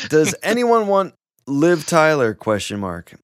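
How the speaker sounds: loud mock mum post reading; reading a quote. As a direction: read the post aloud with gusto.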